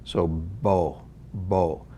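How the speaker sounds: "bo" with a rounded oh vowel; oh-coloured bo. Shown as a demonstration. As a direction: lean the vowel toward o in "bo". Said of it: "more of an oh sound to it".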